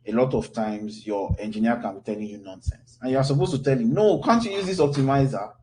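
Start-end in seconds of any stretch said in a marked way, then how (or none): none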